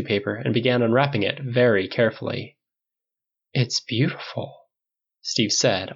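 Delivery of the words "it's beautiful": slow, hushed admiration; almost reverent. A narrator's reading. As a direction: say it slowly, in quiet awe. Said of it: "almost reverently".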